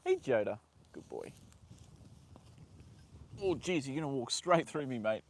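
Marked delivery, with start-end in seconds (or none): 0.9-1.3 soft praise. Real, not acted. Owner softly praising her horse, hushed and tender. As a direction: coo it gently to a nuzzling horse.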